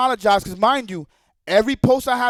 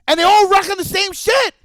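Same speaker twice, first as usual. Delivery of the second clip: exasperated shout; 6 semitones higher, 4 dB louder than usual. Real, not acted. Ranting about repeated posts.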